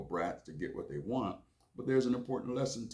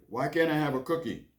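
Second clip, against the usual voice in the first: put-on higher, louder pleading child; whiny kid voice; 7 semitones higher, 5 dB louder than usual. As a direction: beg like a little boy wanting a cookie. Child Billy begs mom.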